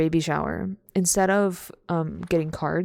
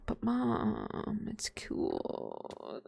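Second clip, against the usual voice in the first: whispered plea; 11 semitones lower, 10 dB quieter, much slower than usual. Retold or imitated. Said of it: "I'd be like".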